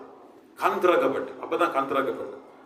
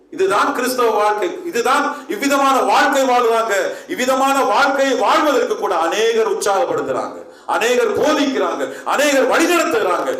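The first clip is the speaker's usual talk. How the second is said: loud, high, driving pulpit delivery; forceful preaching; 7 semitones higher, 5 dB louder than usual. Acted. Preacher rousing his congregation, loud and high. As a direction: hammer each line home to the church.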